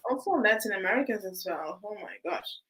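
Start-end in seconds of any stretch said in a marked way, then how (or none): none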